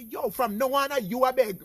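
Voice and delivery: high, loud retold outcry; imitating a loud, high-pitched challenge; mock outcry. Acts out people challenging his begging.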